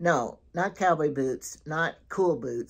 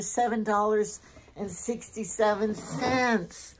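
thrilled about bowls bought cheap, pitched up; excited about bargain; 6 semitones higher than usual. Real, not acted. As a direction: gush over the bargain.

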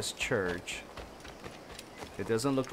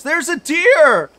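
very high, loud, drawn-out there's a deer; squealing with delight at the deer; shrieked discovery and curse; excited shriek; 13 dB louder, slower than usual. Real, not acted. Spotting a deer suddenly.

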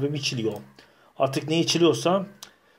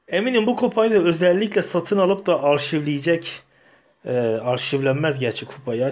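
usual speaking voice

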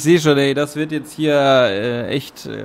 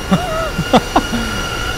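laugh; 11 semitones higher than usual. Real, not acted. Laughs at something on screen.

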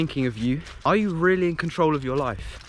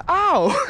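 high sudden ow as something twinges; sharp yelp of pain; 6 dB louder than usual. Real, not acted. Vlogger hurting his neck mid-intro, sharp ow.

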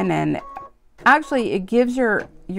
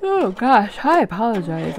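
talking through a yawn. Real, not acted. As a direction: say it mid-yawn. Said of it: "I'm yawning".

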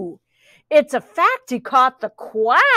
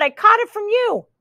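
meowing accusation; 10 semitones higher than usual. Acted. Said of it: "meowed the rabbit".

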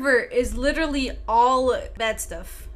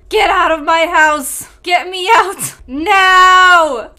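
loud, higher ghostly shout; 5 semitones higher, 11 dB louder, slower than usual. Pretend. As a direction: scream them out of the house, rising to a cry. Pretend ghost scaring players.

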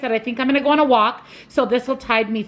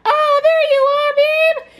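much higher, louder squeal to the dog; 4 dB louder, slower than usual. Real, not acted. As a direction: gush over the dog in a sky-high voice. Greeting the dog, pet talk.

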